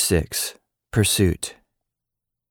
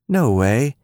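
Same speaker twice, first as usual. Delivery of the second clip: dismissive; 6 semitones higher than usual. A narrator's reading. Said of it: "Emmy dismissed her".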